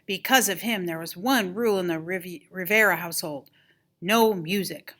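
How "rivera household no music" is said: ordinary delivery, nothing marked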